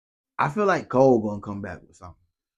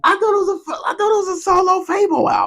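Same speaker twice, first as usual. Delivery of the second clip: surprised disbelief; 4 dB louder, faster than usual. Real, not acted. Surprised, disbelieving the album claim.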